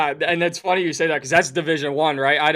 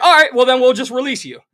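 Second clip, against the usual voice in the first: louder, higher mock team official; retold ultimatum; 8 semitones higher, 9 dB louder than usual. Team officials calling a player's bluff, raised-voice ultimatum. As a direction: raise voice and pitch to call the bluff.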